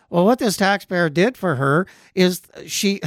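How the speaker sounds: ordinary delivery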